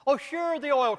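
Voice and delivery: high, mocking "Oh, sure" with a leap up on "Oh"; sarcastic oh sure. Real, not acted. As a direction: scoff at the idea. Sarcastic dismissal of big oil excuse.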